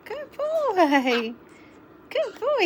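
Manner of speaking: very high loud pet praise. Real, not acted. Owner praising her bird, high pet talk.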